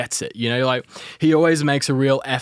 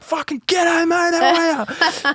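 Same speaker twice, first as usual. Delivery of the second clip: Australian turned all the way up like Steve Irwin; exaggerated Australian; slower than usual. Reporter greeting tired celebrities in a hammed-up Australian accent. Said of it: "I turn the Australian accent all the way up".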